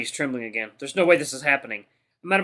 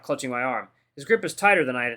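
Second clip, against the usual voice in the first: plain reading voice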